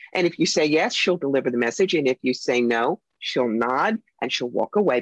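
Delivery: ordinary talk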